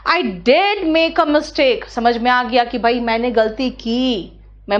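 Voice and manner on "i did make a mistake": "did" punched, very slow and deliberate; stress on did. Shown as a demonstration. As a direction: lean on "did" to admit it fully. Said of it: "did पर जोर दे दो".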